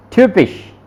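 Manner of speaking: ट्यूपिश with a rounded ü vowel; German typisch. Shown as a demonstration. Tutor showing German typisch to a Hindi speaker. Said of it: "इसका sound निकल रहा है ये".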